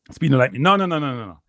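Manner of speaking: ordinary talk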